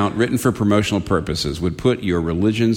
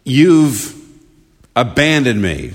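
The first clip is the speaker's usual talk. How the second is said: higher, louder cry of reproach; anguished accusation; 4 semitones higher, 3 dB louder than usual. Acted. Jesus speaking to God from the cross, lightly acted anguished accusation. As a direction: cry out as if let down. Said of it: "Here he's saying to God".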